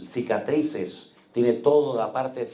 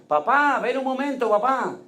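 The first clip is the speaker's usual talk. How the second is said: high, pleading call of papá, pitched well up; a lost girl calling out for her father; confused daughter calling; 12 semitones higher than usual. Retold or imitated. Sick daughter calling her father, high and pleading.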